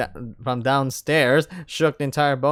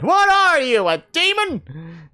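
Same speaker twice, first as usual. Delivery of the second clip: startled; 11 semitones higher, 3 dB louder, slower than usual. Acted. Startled question.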